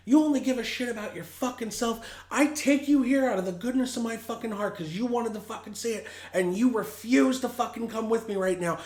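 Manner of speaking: put-on yelling at a drunk friend to leave; retold screaming. Furious yell at drunk friend to come outside. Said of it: "I start screaming at him".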